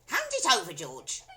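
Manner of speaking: high, loud command; bossy grandma. A narrator's reading. Grandma ordering George. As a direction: demand the cup.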